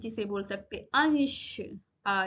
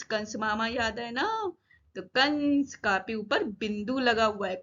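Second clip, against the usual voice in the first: usual speaking voice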